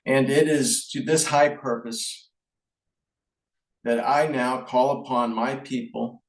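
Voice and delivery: formal speech reading. Shown as a demonstration. Reading the King's wartime speech to the audience, solemn measured address. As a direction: read it like a wartime king to his nation. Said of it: "I want to conclude my speech with reading the King's Speech".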